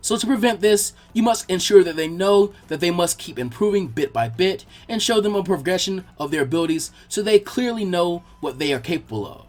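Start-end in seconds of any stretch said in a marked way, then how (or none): none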